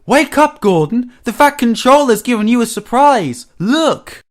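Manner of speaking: high, loud, breaking the big news; thrilled high-pitched hollering; excited shout. A narrator's reading. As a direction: call out excitedly to rouse a sleeper. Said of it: "his driver ran back, excited".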